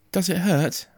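put-on high-pitched boy's voice, concerned; worried young boy. A narrator's reading. Boy checking on his injured father, worried. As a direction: ask as an anxious child.

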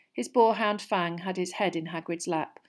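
ordinary delivery, nothing marked

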